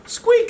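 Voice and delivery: a mouse squeaking hello to the duck. Acted. Mouse answers duck in story.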